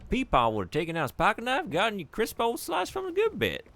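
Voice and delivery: a high, loud, affectionate grandpa impression; hammed-up grandpa. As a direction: squeak it out like a doting old grandpa. Voicing grandpa's apple habits.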